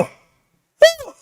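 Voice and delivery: very high falsetto woo; excited whoop. Real, not acted. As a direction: let out a joyful high-pitched whoop. Stock price spikes, celebrating.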